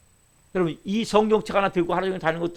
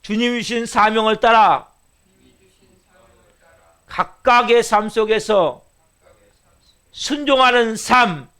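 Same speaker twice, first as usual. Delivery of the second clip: loud, very slow, every syllable spaced for the church to echo; slow call to repeat; 3 semitones higher, 9 dB louder, slower than usual. Shown as a demonstration. Pastor leads church in repeating, slow and loud. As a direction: lead the congregation line by line, drawn out. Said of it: "같이 한번 따라해 봅시다".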